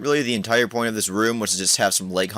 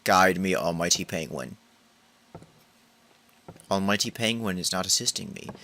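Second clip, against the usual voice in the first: ordinary talk, nothing marked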